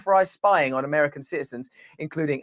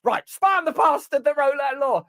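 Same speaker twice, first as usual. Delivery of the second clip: mock outrage; 10 semitones higher than usual. Skit, order then protest.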